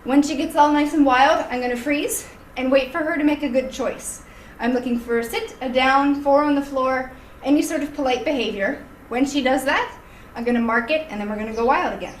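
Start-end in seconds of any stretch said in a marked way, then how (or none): none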